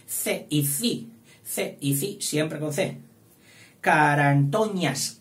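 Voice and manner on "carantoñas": "Carantoñas" drawn out loud and slow; slow dictation. Shown as a demonstration. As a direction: dictate "Carantoñas" with long, stretched syllables. Teacher dictates the word slowly.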